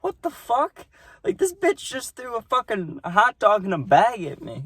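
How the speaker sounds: high, shocked disbelief; retold shocked reaction. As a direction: squeal it out in stunned outrage. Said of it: "I was, like, shocked".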